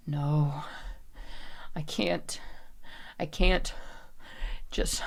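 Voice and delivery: halting, hushed words with long gaps; struggling, near-whisper. Acted. Character barely holding on, halting desperate whisper. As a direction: fight for control under your breath.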